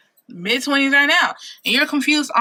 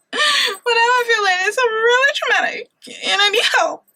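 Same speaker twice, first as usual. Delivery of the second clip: mock crying plea; 11 semitones higher than usual. Vlogger begging viewers for help, mock crying. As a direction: wail it like a fake breakdown for laughs. Hammed-up plea about emotions.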